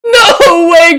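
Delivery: high, loud cry of disbelief; shocked shout. Real, not acted. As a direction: yelp out in total shock. Shocked by the tweet reaction.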